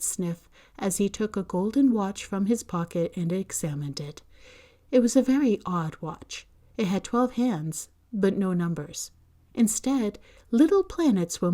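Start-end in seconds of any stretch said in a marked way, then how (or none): none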